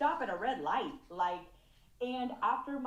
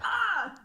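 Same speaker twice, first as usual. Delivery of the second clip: short high mock yell of frustration; frustrated scream; 11 semitones higher, 6 dB louder than usual. Venting about Texas traffic, a short high frustrated yell. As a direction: let out a quick exasperated shout. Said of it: "I'm like".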